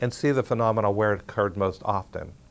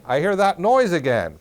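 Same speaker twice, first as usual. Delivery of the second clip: imitating a friend calling out, higher and louder; calling from another room; 6 semitones higher, 5 dB louder than usual. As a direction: call it through to the next room. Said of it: "from the other room saying".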